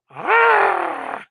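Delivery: high loud burst of a startled cry; a mock trumpeting cry from behind the doors; sudden yelp. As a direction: belt out a sharp startled "Ah!". Sudden noise heard in hallway.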